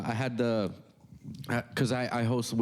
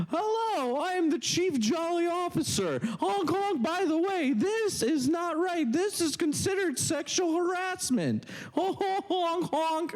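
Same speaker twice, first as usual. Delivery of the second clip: very high squeaky clown voice; honking voice. Retold or imitated. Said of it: "and he's like".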